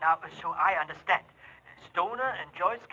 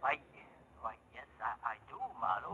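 low, much softer reluctant admission; hesitant; 9 semitones lower, 13 dB quieter, faster than usual. Acted. Client reluctantly admitting he owns a gun, low and soft. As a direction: admit it under your breath.

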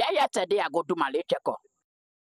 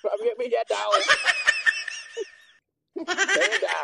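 laughing shout; 7 semitones higher, slower than usual. Real, not acted. Laughing commands.

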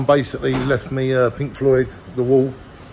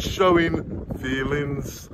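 mock judge; 5 dB quieter, much slower than usual. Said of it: "the judge".

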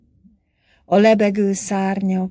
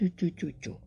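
amorous cooing; 9 dB quieter, much faster than usual. A narrator's reading. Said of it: "csücsörgette a hangját szerelmetesen".

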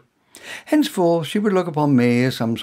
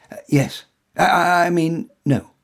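flustered stammer; 4 semitones higher, 7 dB louder than usual. Acted. Tongue-tied hero stammers.

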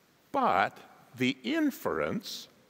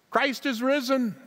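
"risen" proclaimed much higher and louder; proclaiming; 12 semitones higher, 4 dB louder than usual. Real, not acted. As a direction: call it out as a triumphant declaration. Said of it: "say it with emphasis".

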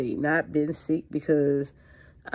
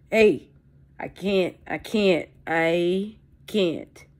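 loud outburst; 3 semitones higher, 6 dB louder, slower than usual. Real, not acted. Outburst about quitting.

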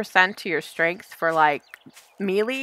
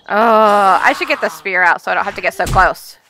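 ordinary delivery

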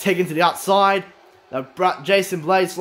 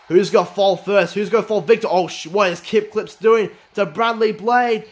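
ordinary talk, nothing marked

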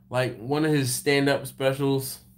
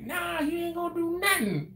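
high mock woman's voice; 4 dB quieter than usual. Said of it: "She's like".

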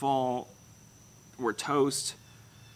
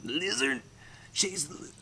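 put-on Australian accent, as if something's in the throat; throaty mock accent; 4 semitones higher, 4 dB quieter than usual. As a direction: slip into a throaty Aussie caricature. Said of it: "sound like something's in my throat".

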